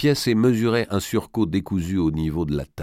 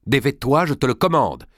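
higher, forceful maternal order; stern command; 6 semitones higher, 3 dB louder than usual. Acted. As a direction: order your daughter to obey at once. Mother orders daughter to undress.

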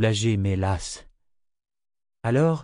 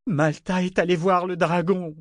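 high, plaintive whine; high-pitched drunken insistence; drunken wail; 8 semitones higher than usual. A narrator's reading. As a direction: whine it out to mother. Drunk man wails to his mother.

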